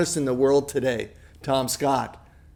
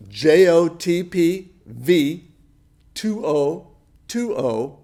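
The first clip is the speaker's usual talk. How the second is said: slow dictation; slower than usual. Real, not acted. Dictating text code for donors.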